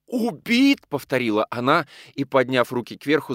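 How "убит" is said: high horrified cry. A narrator's reading. Mistress learns of the killing, horrified. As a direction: echo the word in rising horror. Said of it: "с искажённым от ужаса лицом".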